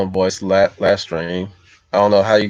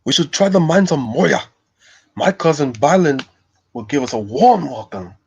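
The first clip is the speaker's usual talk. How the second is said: higher, hearty dwarf impression; Gimli; 6 semitones higher than usual. Streamer as dwarf proposing the mines, Gimli voice. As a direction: boast of your kin as a dwarf, pitched up. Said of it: "I can do my Gimli voice".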